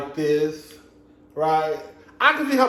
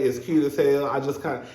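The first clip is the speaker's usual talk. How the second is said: normal talking voice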